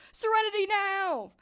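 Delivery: high, loud strained yell; hammed-up yell; mock yell. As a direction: scream it to calm yourself. Frank's famous yelled line.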